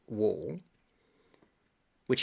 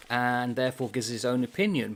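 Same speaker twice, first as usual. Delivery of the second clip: usual speaking voice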